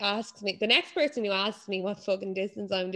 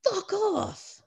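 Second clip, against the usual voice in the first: exasperated mock retort; 12 semitones higher than usual. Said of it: "you're like".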